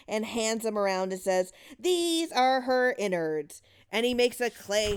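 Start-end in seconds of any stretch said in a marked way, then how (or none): 1.8-3.6 mock spooky announcement. Father plays the witch game.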